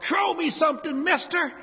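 imitating a Mardi Gras crowd yell. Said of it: "everybody in the crowd yells out".

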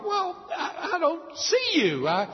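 high, put-on child voice; crying little girl. Little girl begs mother for light, high pleading child voice. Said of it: "what are you crying about".

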